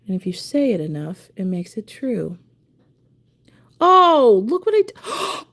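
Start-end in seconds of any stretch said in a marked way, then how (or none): 3.8-5.5 high, loud alarmed oh; alarmed cry. Real, not acted. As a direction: yelp at the slip. Sees her mistake.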